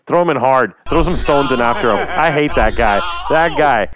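loud, fired-up rant; heated rant. Real, not acted. Hockey fan ranting loudly about a hated player, fired up. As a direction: vent your hatred of a rival player. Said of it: "Let that anger flow through your body".

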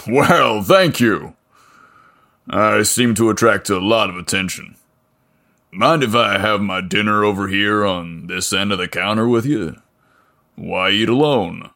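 a lightly voiced burly mechanic, welcoming; friendly big mechanic. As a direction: greet him like a friendly small-town giant. Voices Leo.

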